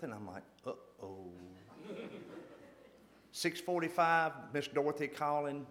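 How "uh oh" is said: uh-oh. Retold or imitated. Dread at the caller's name.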